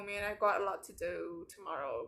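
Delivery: normal talking voice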